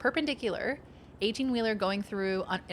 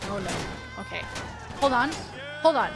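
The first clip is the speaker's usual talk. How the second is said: normal talking voice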